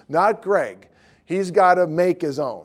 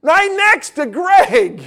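much higher, louder and slow; excited punchline; 12 semitones higher, 10 dB louder, much slower than usual. Real, not acted. Telling the congregation the punchline, high, loud and drawn out. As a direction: stretch it out big, the payoff.

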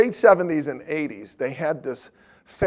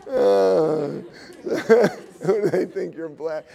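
ordinary delivery

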